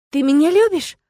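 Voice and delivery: startled, high-pitched query; astonished question. A narrator's reading. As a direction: ask it as if you cannot believe it. Startled question after his confession.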